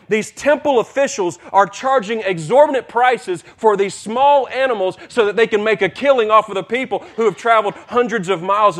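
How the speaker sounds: high, loud, driving sermon delivery; full-force preaching. Acted. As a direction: preach the temple scene with rising fire.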